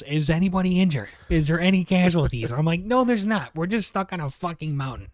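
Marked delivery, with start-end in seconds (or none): 0.0-2.5 loud, raised mock-urgent questions; emphatic, pitched-up retold text; all-caps texting. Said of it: "they're texting all capitals". 2.8-5.1 high, raised exasperated comeback; retold indignant outburst; exasperated retort. Retold exasperated reply to dispatcher.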